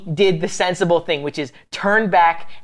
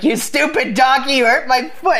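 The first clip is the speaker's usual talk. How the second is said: higher, hammed-up angry rider; mock angry Balaam; 8 semitones higher than usual. Rider scolding his donkey over his foot, mock angry.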